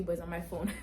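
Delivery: normal talking voice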